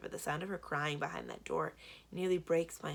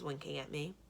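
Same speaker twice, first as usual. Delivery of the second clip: usual speaking voice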